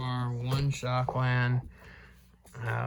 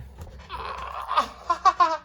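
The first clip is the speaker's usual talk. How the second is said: pained laugh. Real, not acted. Sudden pain from stubbed toe.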